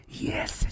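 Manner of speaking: whispered "Yes" with a long stuttering hiss; creepy extra S's. Retold or imitated. Mocking the creep's spelling, whispered "Yes" with endless S's. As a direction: whisper "Yes", then keep pulsing the final s. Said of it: "adding to the creep factor".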